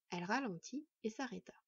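plain reading voice

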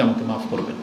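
mock servant asking to be excused; meek plea. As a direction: shrink down and ask pardon like a servant. Slave begging the rich to excuse.

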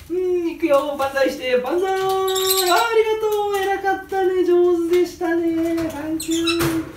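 high, slow sing-song praise; high praising voice. Real, not acted. Praising the bird warmly. (鳥を褒める高い優しい声)

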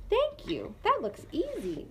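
high eager little beetle; bright, keen youngster; young beetle voice. Acted.

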